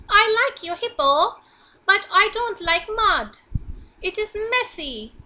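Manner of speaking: high, loud, fussy frog; little frog voice. Acted. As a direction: squeak it up as the tiny complaining frog. Frog character speaks.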